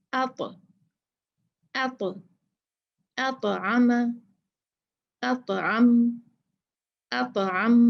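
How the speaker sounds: अत with the ta bounced, then अतअम read slowly and clearly; qalqalah on ta. Shown as a demonstration. Teacher showing students the ta bounce in अतअम. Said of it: "दूसरी ता की मिसाल देखते हैं".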